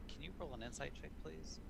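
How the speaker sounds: quick, soft pleading ask; pleading look. Acted. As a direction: plead with your eyes, fast and hushed. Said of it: "look in my eyes of".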